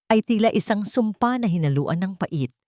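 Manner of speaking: usual speaking voice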